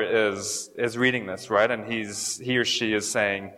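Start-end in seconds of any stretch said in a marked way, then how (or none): none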